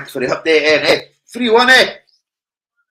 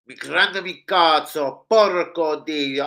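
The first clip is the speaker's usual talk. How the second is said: slow mock Italian exclamations; much slower than usual. Fan doing mock Italian, slow. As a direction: ham up Italian swearing slowly.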